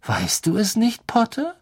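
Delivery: high, needling question, not really whispered; taunting murmur. A narrator's reading. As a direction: tease a rival with a secret. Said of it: "flüsterte Malfoy". (hoch und lauernd gestichelt)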